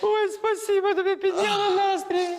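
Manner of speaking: high squealing thanks after a laughing fit; laughter aftermath. Acted. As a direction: thank her, still giddy from laughing. (високо, ще не відсміявшись)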